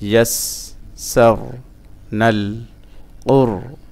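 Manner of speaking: ইয়াসসারনাল কুরআনা split into syllables with long gaps; broken-up syllables. Shown as a demonstration. Teacher drilling learners, halting syllable-by-syllable chant. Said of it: "ভাঙা ভাঙা উচ্চারণ".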